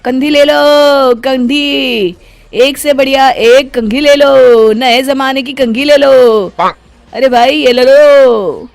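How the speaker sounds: loud, high, drawn-out sales call; street hawker's cry. Acted. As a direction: stretch out each call like a street vendor. Street seller hawking combs. (फेरीवाले की लंबी, ऊँची पुकार)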